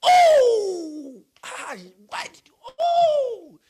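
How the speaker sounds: high loud anguished oh; a hurt voice groaning at someone; exclamation demo. Shown as a demonstration. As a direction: sigh out a pained exclamation. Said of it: "it's like exclamation".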